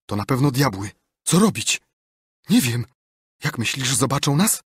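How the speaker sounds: high, loud frightened voice; panicked fear. Acted. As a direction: voice climbing in terror at the devils. Boys panic at graveyard.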